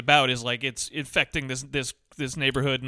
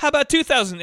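mock junkie mom haggling; 10 semitones higher, 6 dB louder than usual. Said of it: "she goes".

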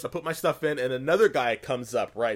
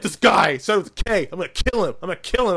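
higher, louder hammed-up fury; dramatic rage; 5 semitones higher, 7 dB louder, faster than usual. Retelling Joe swearing revenge on the rogue player, mock fury. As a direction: play up his anger for the story. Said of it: "God, you make me sound so dramatic".

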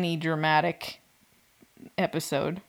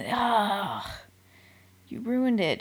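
ordinary talk

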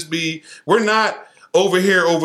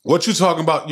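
usual speaking voice

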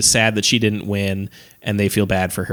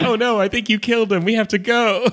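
mock panic; 12 semitones higher than usual. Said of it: "And they're like".

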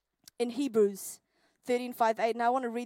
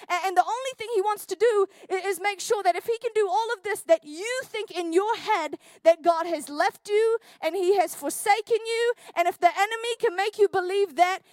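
louder, pressing sermon delivery; preaching at full force; 5 semitones higher, 4 dB louder than usual. Acted. Preacher warning the church about the enemy, forceful.

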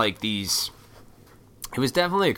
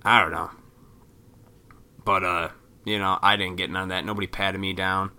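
ordinary delivery

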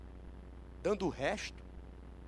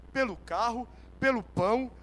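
ordinary talk

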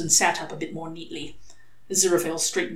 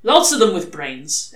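ordinary delivery

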